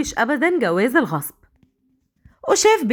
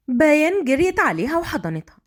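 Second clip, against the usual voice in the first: ordinary delivery, nothing marked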